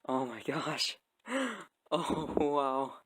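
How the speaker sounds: awed gasp, jumping high then loud; excited. Real, not acted. Builder first seeing the truck whole, excited awe. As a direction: gasp and marvel, really thrilled. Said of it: "this is just so freaking exciting for me".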